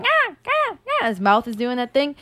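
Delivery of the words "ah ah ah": high, short animal yelps; imitated groundhog call. Showing co-host the groundhog's noise, high imitated yelps. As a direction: mimic a groundhog calling out. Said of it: "whatever sound he's making".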